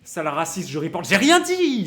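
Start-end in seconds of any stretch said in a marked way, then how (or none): 1.1-1.9 loud, high protest of innocence; mock protest. Streamer protesting to his chat, loud mock denial. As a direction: yelp it as if falsely accused.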